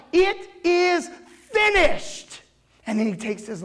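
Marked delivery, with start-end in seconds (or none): 0.1-2.4 high, ringing yell; retold yell. Jesus crying out from the cross, high yell. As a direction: cry it out in two bursts, pitched way up. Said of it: "he yells out".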